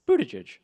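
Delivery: Buttigieg run together as "BOO-tuh-jej"; easy pronunciation. Shown as a demonstration. Host shows the easy way, Buttigieg said as "BOO-tuh-jej". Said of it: "there's an easy way to do this".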